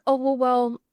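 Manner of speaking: ordinary delivery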